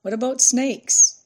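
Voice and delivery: high, loud shouted question; calling out. A narrator's reading. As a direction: shout the question over to him.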